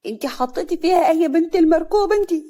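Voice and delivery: high, loud wail of bellyache; acted pain, shrieking upward; pained accusation. A narrator's reading. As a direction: accuse her through stomach cramps. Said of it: "سعاد هي كمان ماسكة بطنها".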